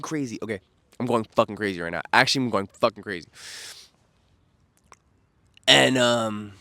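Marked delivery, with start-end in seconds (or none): none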